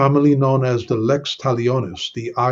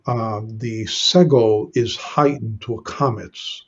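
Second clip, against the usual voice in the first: ordinary talk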